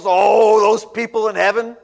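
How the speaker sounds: loud mocking shout at heaven; mock angry rant. A critic shaking his fist at heaven, loud mock rant. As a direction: shake your fist and rant, hammed-up. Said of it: "Who shakes an angry fist and goes".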